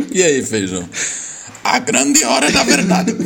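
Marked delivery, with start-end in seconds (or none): 1.6-3.1 dramatic announcer. Pretend. Dramatic game-show reveal.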